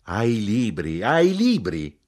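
mounting, overwhelmed outcry; shouted. A narrator's reading. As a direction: repeat it, rising into a shout. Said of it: "gridò".

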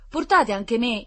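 high, loud pleading; imploring. A narrator's reading. Boy with a plastered leg begs to come, imploring. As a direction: beg like a child left behind. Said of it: "implorava un bambino".

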